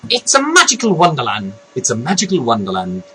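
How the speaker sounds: put-on soft rehearsal of an Irish accent; tentative Irish voice. Vlogger rehearsing the character's catchphrase, tentative Irish. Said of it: "I can't do the Irish voice".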